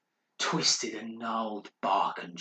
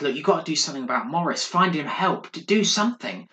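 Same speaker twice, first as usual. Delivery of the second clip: fast, rising, louder demand; urgent plea; 5 semitones higher, 5 dB louder than usual. Acted. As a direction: push harder with every phrase. Scared guest urges the butler.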